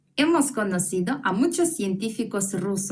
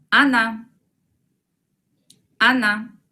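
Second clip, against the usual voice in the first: loud model word; 5 dB louder than usual. Shown as a demonstration. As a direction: call out Ana for the class to repeat. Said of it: "Miren la transcripción". (pronunciación fuerte y clara)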